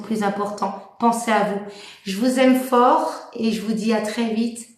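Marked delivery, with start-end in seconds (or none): none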